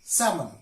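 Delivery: SALMON as "sam-un", L left out; SALMON with A straight into M; silent L. Shown as a demonstration. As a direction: correct SALMON by dropping the L. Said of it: "L is silent in these words".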